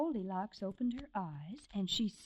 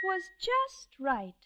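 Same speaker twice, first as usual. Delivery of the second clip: just right sung out high; pleased lift on just right; delighted; 9 semitones higher, slower than usual. A narrator's reading. Narrator to a child, delighted and high.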